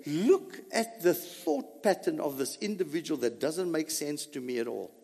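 normal talking voice

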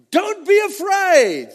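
high, loud, drawn-out quote; commanding proclamation. Acted. Preacher voices Christ addressing John, commanding proclamation. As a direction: declare it slowly and forcefully.

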